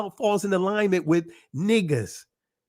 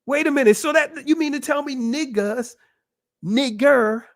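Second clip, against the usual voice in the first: mock surprise; 7 semitones higher than usual.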